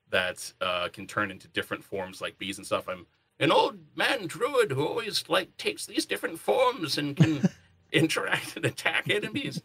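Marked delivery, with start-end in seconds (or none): 3.4-9.6 put-on high, far-off silly old man voice; old man druid voice. Shown as a demonstration. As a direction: play a goofy old wizard druid. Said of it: "they wanted me to kind of go for that voice".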